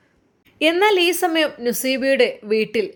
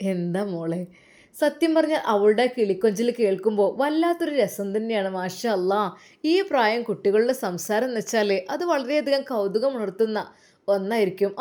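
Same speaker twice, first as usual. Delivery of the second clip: ordinary delivery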